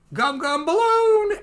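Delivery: high, drawn-out hero call; attack call. Acted. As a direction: stretch the move name out high. Acting Luffy's attack call.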